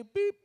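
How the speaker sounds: high sharp beep sound; scanner beep. Retold or imitated. Comedian mimics the checkout scanner, high beep. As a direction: chirp like a barcode scanner.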